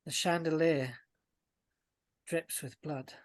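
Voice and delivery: slow narration with long suspenseful pauses; ominous scene setting. Acted. Game master revealing blood on the stage, ominous. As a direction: let each horror land slowly, softening on the drips.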